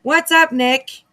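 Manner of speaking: high, loud mock scolding of Nick; teasing shout at Nick; mock call-out. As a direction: call him out, half joking.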